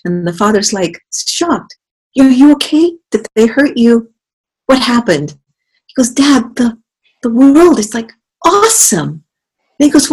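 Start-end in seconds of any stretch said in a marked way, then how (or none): 2.2-5.4 high, loud alarmed questions; shocked father. Retold or imitated. Father shocked to see his son back, alarmed high voice. 6.1-9.2 put-on high, loud awed kid voice; excited baby mosquito. Baby mosquito telling his dad, high excited voice.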